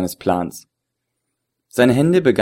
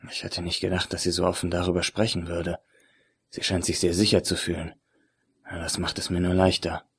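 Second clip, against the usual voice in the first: much softer, hushed inner voice; inner thought; 3 semitones lower, 10 dB quieter than usual. Acted. The agent sizes up the woman in his head, much softer. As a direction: think it privately to yourself.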